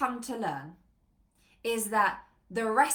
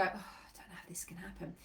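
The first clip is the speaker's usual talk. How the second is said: low, whispery, sighing doubt; acted hushed, defeated inner voice; self-doubt; 4 semitones lower, 12 dB quieter than usual. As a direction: breathe it out as if losing heart. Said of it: "You start to doubt yourself again".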